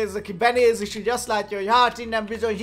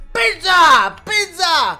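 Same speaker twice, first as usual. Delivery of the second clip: much higher, loud shouted jingle voice; loud high cartoonish ad shout; pizza ad voice; 8 semitones higher, 10 dB louder, much slower than usual. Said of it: "van benne egy ilyen hang".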